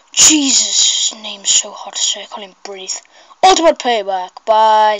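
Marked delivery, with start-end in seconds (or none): 2.2-2.9 breathless whisper. Real, not acted. Struggling to read a long username, breathless whisper.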